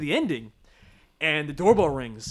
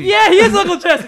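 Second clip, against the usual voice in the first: excited; 12 semitones higher, 11 dB louder than usual. Real, not acted. Excited realization.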